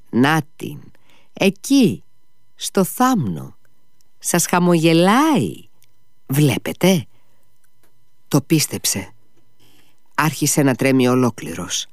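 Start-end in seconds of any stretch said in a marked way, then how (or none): 0.1-7.0 higher, slow coaxing pointing; a lightly voiced eager lure; luring. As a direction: tempt him to lean out and look. Luring him to lean out.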